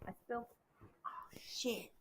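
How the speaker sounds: barely voiced, hushed curse; breathless whisper of oh, shit. Real, not acted. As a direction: whisper it to yourself in dismay. Quietly dealing with spill.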